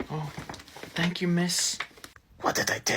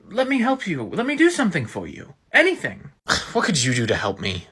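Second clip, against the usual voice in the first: plain reading voice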